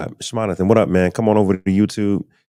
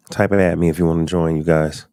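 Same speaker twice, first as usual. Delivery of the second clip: ordinary talk, nothing marked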